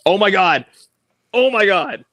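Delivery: loud high disbelief; excited shout. Real, not acted. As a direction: shout it at the game. Reacting to the game.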